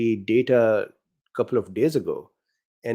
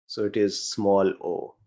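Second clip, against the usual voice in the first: ordinary talk, nothing marked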